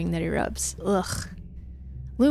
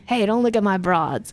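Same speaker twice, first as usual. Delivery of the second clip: ordinary talk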